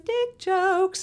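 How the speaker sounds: high, drawn-out singsong; mock-proud, slow and high punchline; playful singsong. Shown as a demonstration. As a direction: stretch it out high for comic effect. Gleefully announcing her favourite punchline.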